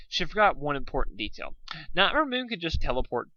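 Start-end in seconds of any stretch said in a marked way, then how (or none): none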